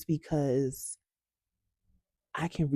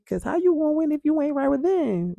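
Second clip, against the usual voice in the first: much higher and louder, proclaimed; belted catchphrase; 12 semitones higher, 7 dB louder than usual. Shown as a demonstration. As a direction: shout the motto like it's stuck in your head. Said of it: "that lives in my head rent free".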